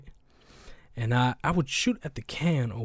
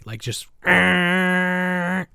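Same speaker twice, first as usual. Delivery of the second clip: honk imitation; 6 semitones higher, 6 dB louder, much slower than usual. Imitating a car honking.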